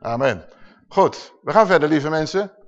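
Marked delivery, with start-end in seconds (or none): none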